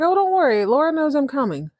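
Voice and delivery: put-on breezy and high, brushing off the babysitter's worry; light, carefree reassurance, way up in pitch; breezy Richard. Richard waving off the nervous babysitter, breezy and high. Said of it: "Richard's like".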